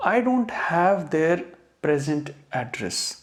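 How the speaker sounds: slow model sentence, present said PREZ-unt; adjective stress. Shown as a demonstration. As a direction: stress the first syllable of present. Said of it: "तो ये बोलेंगे हम यहाँ पे present".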